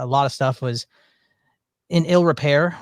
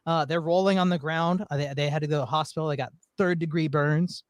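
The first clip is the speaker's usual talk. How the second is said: ordinary delivery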